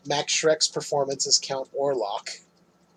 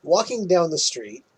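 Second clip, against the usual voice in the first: usual speaking voice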